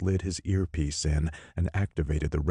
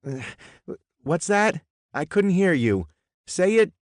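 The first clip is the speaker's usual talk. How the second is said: much higher, loud into the phone; shouting into phone; 14 semitones higher, 6 dB louder than usual. Acted. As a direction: strain to be heard on a bad line. Bad phone connection.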